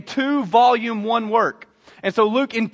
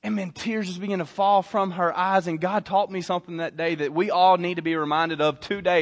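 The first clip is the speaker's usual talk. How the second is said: usual speaking voice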